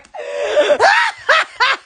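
high cackling laugh; uncontrollable laughter. Real, not acted. Host apologising to viewers for cracking up, helpless high laughter. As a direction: burst out helplessly after apologising.

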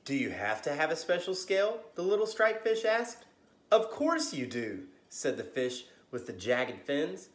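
lively bedtime storybook reading; story narration. A narrator's reading. Storyteller reading to kids at bedtime, lively. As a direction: read it like a children's librarian at story time.

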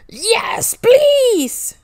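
put-on a child who can't believe his luck; thrilled yes. Joe answering Mom's birthday party offer, thrilled.

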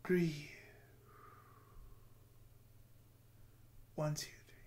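soft, slow counting and breathing cues; guided meditation. Acted. Vlogger guiding viewers through a breathing meditation, softly. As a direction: lead the breath gently, counting.

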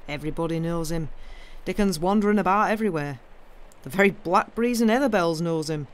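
put-on lively old moor man; blunt Yorkshire gardener, enthusiastic. Gardener telling the girl about Dickon, emphatic Yorkshire. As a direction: sing someone's praises in broad Yorkshire.

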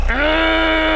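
long, very high held "aah"; sustained high massage-gun groan; drawn-out "ah". Shown as a demonstration. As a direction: hold a high moan under the massage gun. Groaning while using massage gun.

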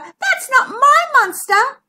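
high, loud little-cousin voice; squeaky. A narrator's reading. Zara rejects the lizard as her monster, squeaking. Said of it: "squeaked Zara".

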